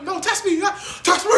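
high, loud dare; mock fighting challenge. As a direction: goad him in a hammed-up yell.

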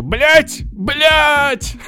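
high loud swearing shout; yelling. Retold or imitated. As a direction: shout the curse at full force. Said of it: "очень громко кричу".

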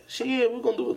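high-pitched mock going along; put-on high voice, falsely agreeable; fake agreement. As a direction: imitate yes-men agreeing against their will. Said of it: "y'all really deep down don't like that shit".

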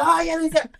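high, loud burst of excitement; loud, high-pitched delight; excited reaction. Retold or imitated. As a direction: squeal as if your favorite song came on. Acting out hearing favorite song.

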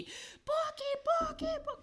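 high, drawn-out call from far away; distant calling out. Retold or imitated. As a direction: call her name from far off. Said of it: "yo escuchaba a Jesús". (llamado agudo y lejano)

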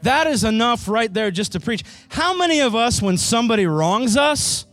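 loud, high preaching to the church. Real, not acted. Pastor preaching to his congregation at full force. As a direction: preach it out, rising with fervour.

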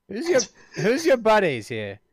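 high, loud British question; British accent. Acted. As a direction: ask nosily in a put-on British accent. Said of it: "It'll be British".

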